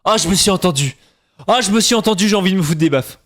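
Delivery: high-pitched horrified outburst at yourself; appalled self-reproach. Real, not acted. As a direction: cry out, mortified by your own accent slip. Appalled at hearing own accent slip.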